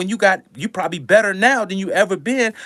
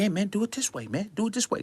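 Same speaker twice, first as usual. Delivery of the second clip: quiet bossy quote; 10 dB quieter, faster than usual. Retold or imitated. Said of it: "They wanna tell you".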